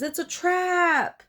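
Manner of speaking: high, slow shouted warning; retold scream. Reich warning everyone of the trap, high scream. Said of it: "And he screams".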